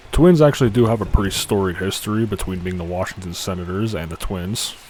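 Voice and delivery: normal talking voice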